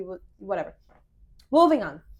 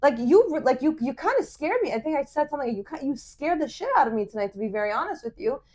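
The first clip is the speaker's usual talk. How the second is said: high, worked-up retold reproach; re-enacted hurt accusation; upset confrontation; 5 semitones higher than usual. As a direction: let him have it, then ease off slightly.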